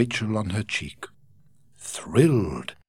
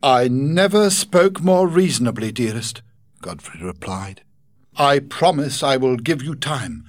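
higher, louder tender reassurance; earnest Godfrey; 6 semitones higher, 6 dB louder than usual. Acted. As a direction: promise her patience.

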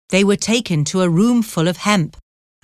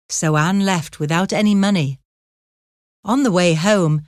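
ordinary delivery, nothing marked

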